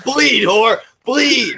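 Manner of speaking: high, hammed-up villainous cry; mock savage yell. Imagining a friend pelting a stripper with coins, mock savage yell. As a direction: yell it high, cracking up at the end. Voicing Samaj pelting the dancer.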